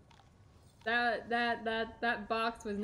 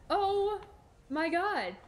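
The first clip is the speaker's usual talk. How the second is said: higher, alarmed oh my god; startled exclamation; 7 semitones higher, much slower than usual. Real, not acted. Streamer catches herself at the edge, alarmed exclamation. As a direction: cry it out in sudden alarm.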